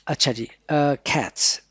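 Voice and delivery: normal talking voice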